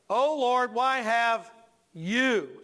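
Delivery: loud accusing lead-up, then a long "you"; ticked-off stress on YOU. Acted. Preacher showing Moses blaming God, hard stress on "you". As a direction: set "you" apart after a pause, accusingly. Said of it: "you're, you're ticked off".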